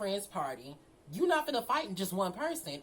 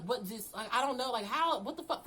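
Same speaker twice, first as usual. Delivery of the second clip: ordinary delivery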